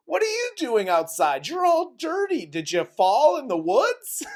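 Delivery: high, loud mocking friends; mock concerned friends. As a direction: ham up the clueless friends. Friends mock-scolding the returning girl.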